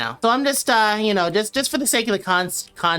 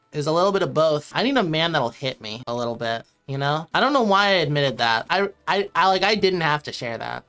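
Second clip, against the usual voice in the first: ordinary talk, nothing marked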